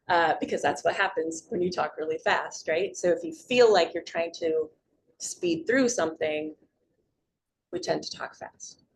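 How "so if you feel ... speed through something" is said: usual speaking voice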